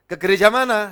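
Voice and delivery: high, loud retold question; loud eager question. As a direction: call it out brightly to the stranger. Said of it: "Saya tanya".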